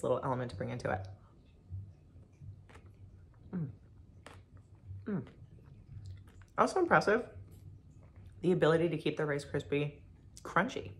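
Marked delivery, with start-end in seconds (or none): 3.5-7.2 humming while chewing; closed mouth savoring bite; mouth full of ice cream. Real, not acted. Seen 0: Just took a spoonful; closed mouth, eyes shut while humming.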